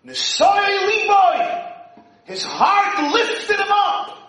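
high, slow, loud proclamation; slow exalted shout. Acted. Rabbi proclaiming Moshe's lifted heart, slow shout. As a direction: lift each word up slowly. Preaching climax.

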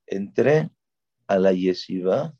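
ordinary talk, nothing marked

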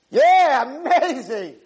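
loud, high mock cheer; feigned enthusiasm. Father humouring his struck-out son, feigned enthusiasm. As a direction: force out over-the-top delight. Father fakes praise after strikeout.

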